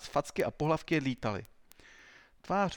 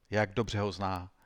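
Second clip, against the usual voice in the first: ordinary delivery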